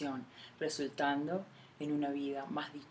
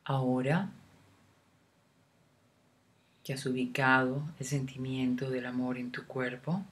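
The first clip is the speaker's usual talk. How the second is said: guided meditation; 4 dB louder than usual. Acted. Guide leading a listener through a love meditation, unhurried meditation voice. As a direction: lead the listener gently inward, phrase by phrase, leaving room to breathe.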